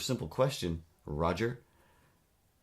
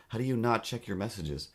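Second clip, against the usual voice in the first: normal talking voice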